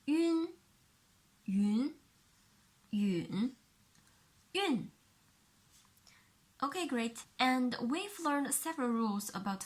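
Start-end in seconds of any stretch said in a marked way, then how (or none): 0.1-4.9 "yun" said through the four tones; "yun" in each tone; four tones demo. Shown as a demonstration. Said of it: "And the next one".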